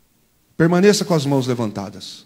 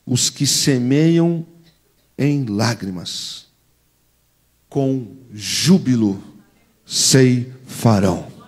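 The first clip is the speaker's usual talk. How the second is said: slow scripture reading; 5 semitones lower, much slower than usual. Real, not acted.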